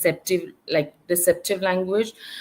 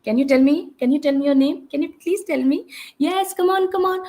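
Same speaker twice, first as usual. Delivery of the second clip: quick, rising, pushy questioning; impatient adult demo; rushing the child; 7 semitones higher than usual. Shown as a demonstration. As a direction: fire questions at the child without pause. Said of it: "So quickly".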